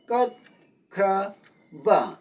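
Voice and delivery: ꯀꯠꯈ꯭ꯔꯕ slowly split into three separate syllables. Shown as a demonstration. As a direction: break ꯀꯠꯈ꯭ꯔꯕ into three beats, a clear pause between each. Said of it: "ꯈꯣꯟꯊꯣꯛ ꯑꯍꯨꯝꯅ ꯁꯥꯏ". (ꯀꯠꯈ꯭ꯔꯕ ꯈꯣꯟꯊꯣꯛ ꯑꯍꯨꯝ ꯇꯣꯉꯥꯟꯅ ꯇꯞꯅ ꯍꯥꯏꯕ)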